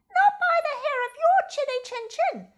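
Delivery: high, loud, snippy chick voice; rude chick. Acted. Chick refusing the fox entry, high and rude. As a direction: slam the door in his face.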